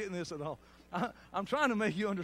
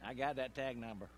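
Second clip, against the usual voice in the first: a hint of a stranger; 6 semitones lower, 4 dB quieter than usual. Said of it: "I heard somebody say this".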